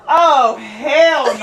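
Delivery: loud, high, drawn-out disbelief; reação chocada. Real, not acted. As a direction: stretch it out in scandalized amusement. Shocked reaction to prank story.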